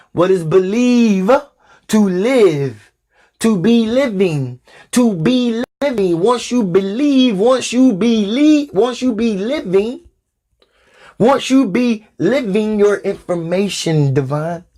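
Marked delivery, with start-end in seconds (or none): 0.1-1.3 "believe" slowed and stretched; stress on "believe". Shown as a demonstration. Preacher asking what the word means, stress on "believe". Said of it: "emphasizing on the word believe". 3.4-10.0 slow, higher-pitched stretch on "believe" and "be living"; emphatic word stress. Shown as a demonstration. Preacher breaking a word open for followers, slow emphatic stress. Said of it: "emphasizing on the word believe".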